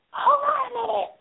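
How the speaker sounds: high falsetto yell as Madea; Madea shouting. Retold or imitated. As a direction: bellow it through the door like Madea. Madea banging on the door.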